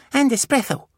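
put-on high eager little-boy voice; a tiny child reaching for treats; toddler voice. Beckett mishears shelves, high and eager.

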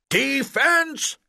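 loud, high yell across the field; coach shouting. A narrator's reading. Coach yelling at the distracted boys on defense, loud shout. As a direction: bellow it to wake up the defenders. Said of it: "Coach shouted".

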